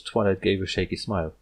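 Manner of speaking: ordinary delivery, nothing marked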